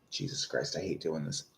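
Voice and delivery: low, soft grumble under the breath; grumbling to self. Real, not acted. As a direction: grumble it quietly, half to yourself. Grumbles to himself while checking the queue.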